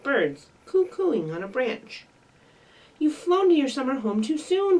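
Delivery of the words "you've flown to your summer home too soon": cried. Acted. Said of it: "Fletcher cried".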